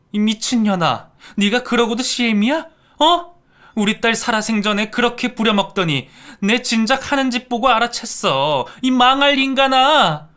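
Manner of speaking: loud, high screaming abuse; furious yelling. Acted. Grieving mother screaming at the in-law at the funeral. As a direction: scream at her with all your rage.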